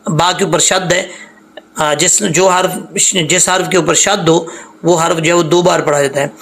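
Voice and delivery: ordinary delivery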